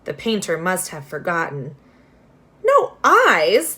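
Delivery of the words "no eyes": loud, outraged boom; a magistrate booming in outrage. Acted. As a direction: boom it out in disbelief. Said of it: "the magistrate boomed".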